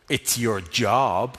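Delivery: very slow, low deadpan; slow flat reply. Retold or imitated. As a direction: drag it out, unimpressed.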